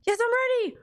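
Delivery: high, excited shout-back; hype response. Pretend. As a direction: answer like a pumped-up crowd. Hype call-and-response with viewers.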